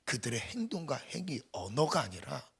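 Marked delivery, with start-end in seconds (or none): none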